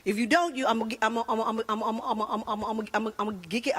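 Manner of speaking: low, rapid stuck repeats of "I'ma"; stuttering. Retold or imitated. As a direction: imitate a stuttered threat that never finishes. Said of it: "My husband stutters".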